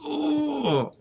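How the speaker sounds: high soft wince of pain; a soft high wince at a sad scene; pained ooh. Retold or imitated. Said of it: "You just kinda go".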